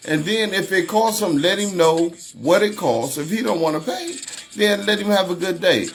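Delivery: exasperated disbelief. Real, not acted. Outraged over shooting over mayonnaise.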